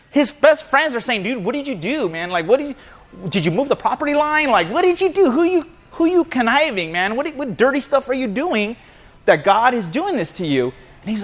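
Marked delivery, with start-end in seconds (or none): 1.2-10.7 high-pitched mock interrogation; acted-out disbelief, pitched well up; incredulous accusing. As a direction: badger him like a disbelieving buddy. Friends accusing Job in retold story.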